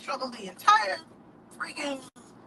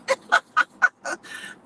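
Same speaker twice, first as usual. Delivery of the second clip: laugh; 13 semitones higher, 4 dB louder than usual. Real, not acted. Laughs after own joke.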